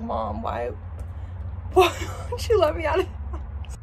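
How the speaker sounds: mock-crying dog, breaking up high; high, whimpering pet voice; Winnie. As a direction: plead as a poor dog, laughing through it. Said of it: "poor Winnie's like".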